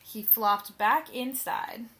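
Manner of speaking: plain reading voice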